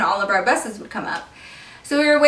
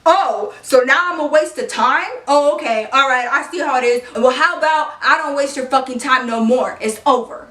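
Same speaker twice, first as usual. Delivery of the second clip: put-on offended boyfriend voice; 3 semitones higher, 3 dB louder than usual. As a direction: play the hurt boyfriend storming off. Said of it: "let me get back into character".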